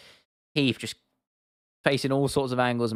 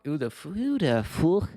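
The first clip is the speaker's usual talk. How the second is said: slow, drawn-out, higher-pitched quoted catchphrase; Conor impression; 5 semitones higher, slower than usual. Streamer quoting a favourite classic line, imitating the fighter. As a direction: stretch it out in a put-on fighter's voice. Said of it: "favorite Conor line he's ever come up with".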